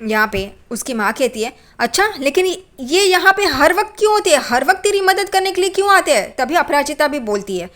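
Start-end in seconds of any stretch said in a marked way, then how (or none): 1.8-6.3 higher, pointed mock questioning; accusing mother. Mother doubting her daughter's friend, suspicious retold questions.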